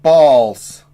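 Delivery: loud, high furious rant; blowing up in anger; angry outburst. Real, not acted. Swearing at absent friend.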